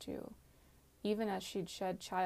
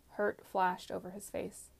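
plain reading voice

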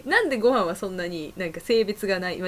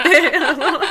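normal talking voice

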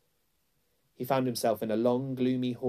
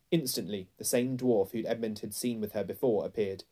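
usual speaking voice

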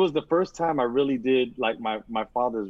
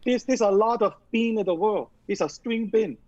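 mock Chinese-accented chef telling a pun; Yan Can Cook impression; 8 semitones higher than usual. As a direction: do the TV chef's punchline voice. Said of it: "like, his joke".

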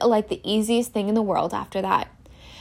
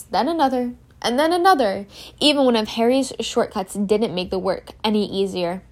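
usual speaking voice

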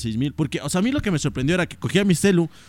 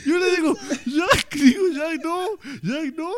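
high cracking cry of "Jack, no"; imitating his own panic, voice pitched high; mock panicked pleading; 14 semitones higher, slower than usual. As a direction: play the desperate owner, half laughing. Reenacting panic over dying dog.

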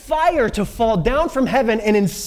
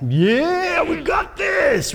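high, drawn-out triumphant shout; full of gusto; 8 semitones higher, slower than usual. Acted. Elijah on the pinnacle of everything, gusto-filled shout. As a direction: hoot it out like a victory cry. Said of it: "with all that gusto".

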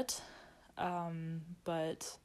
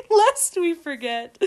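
slow, high, laughing archaic phrase; lightly solemn mock olde line; olde speech; 10 semitones higher, 16 dB louder, slower than usual. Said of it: "I love that phrase also".

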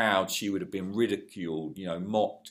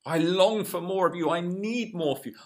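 higher, pleading prayer voice; David's prayer; 8 semitones higher, 3 dB louder than usual. Retold or imitated. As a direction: cry out to God like the psalmist. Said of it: "David was saying".

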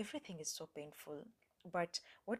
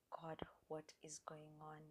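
soft whispered frustrated plea; quiet desperate whisper to God; 8 dB quieter than usual. Retold or imitated. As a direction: breathe the question to God under your breath. Frustrated private prayer to God.

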